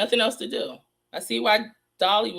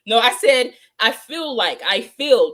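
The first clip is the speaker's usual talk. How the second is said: "I feel like, I feel" with "feel" punched out loud; stress on feel; 4 semitones higher, 6 dB louder, slower than usual. Real, not acted. Host correcting Pig Snake, stressing "feel" loudly. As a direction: hit the word "feel" loud and hard each time. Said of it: "the difference in feel".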